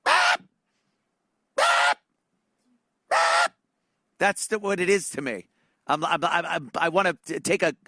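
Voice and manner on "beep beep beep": very high, loud beeping chirps; bird beeping. Retold or imitated. Imitating the bird outside the window with loud high beeps. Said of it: "a bird out your window just going".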